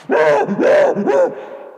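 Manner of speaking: bursting, high-pitched loud laugh; loud high amused cackle. Real, not acted. Laughs at the fake crying.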